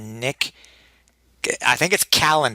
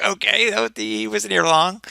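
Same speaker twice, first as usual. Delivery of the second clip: mock exasperation; 8 semitones higher than usual. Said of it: "I was like".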